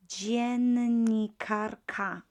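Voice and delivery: dziennikarka said very slowly and loud; slow model word. Shown as a demonstration. Teacher modelling dziennikarka for learners. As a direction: drawl out dziennikarka syllable by syllable. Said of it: "Once again".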